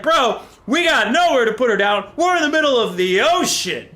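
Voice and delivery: high, loud, fed-up reply; exasperated captain. Retold or imitated. Captain answers his panicking crew, loud and exasperated. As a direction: throw it back at the crew, incredulous. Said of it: "the captain's like".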